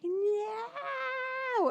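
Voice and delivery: high, anguished cry of no; reliving the moment the vet gave bad news; retold tearful no. Crying out no at the vet's news. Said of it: "burst into tears this morning".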